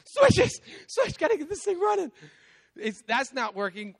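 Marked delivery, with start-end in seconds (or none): 0.1-2.1 much higher and louder, frantic and breathless; panicked scramble. Retold or imitated. Father panicking in his flooding basement, frantic. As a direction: scramble like the water's rising at your feet.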